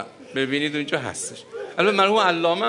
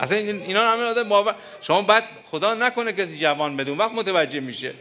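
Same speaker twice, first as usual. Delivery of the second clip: normal talking voice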